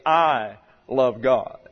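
"I" held long and slow, then love God; stress on I. Shown as a demonstration. Preacher shows what you do not say, stress on I. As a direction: stretch and lean hard on "I". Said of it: "emphasis on the I".

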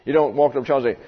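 ordinary talk, nothing marked

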